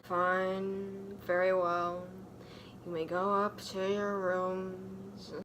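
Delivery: a hint of Snape. Snape dismissing the pair, lightly voiced cool tone. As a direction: let them go, coolly. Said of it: "Snape said".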